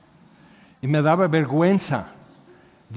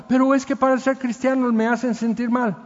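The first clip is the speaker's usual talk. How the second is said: whiny complaint; 6 semitones higher than usual. Retold or imitated. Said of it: "mock complaining believer".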